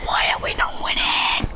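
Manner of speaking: voiceless mock-desperate whisper; whispered wail. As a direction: whisper the complaint like a stifled cry. Losing the match.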